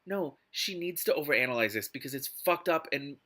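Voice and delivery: normal talking voice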